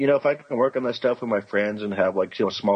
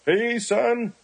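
high-pitched mock hail; put-on high holler after a few drinks; high drunken greeting; 12 semitones higher than usual. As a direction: call it out high, as a tipsy retold greeting. Acting out returning to work drunk.

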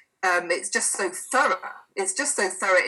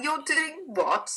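incredulous disbelief; 7 semitones higher, much slower than usual. Retold or imitated. Retold disbelief at a client.

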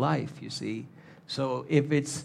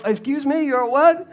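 much higher and louder astonished question; incredulous; 11 semitones higher, 10 dB louder than usual. Acted. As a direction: act scandalised, as if it's unheard of.

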